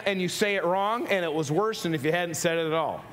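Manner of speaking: normal talking voice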